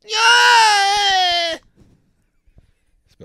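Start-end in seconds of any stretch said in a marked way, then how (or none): 0.0-1.6 long, high, loud yell; demonstrated noise. Pretend. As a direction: let out a drawn-out high cry. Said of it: "Uh, noise".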